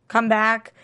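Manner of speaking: ordinary talk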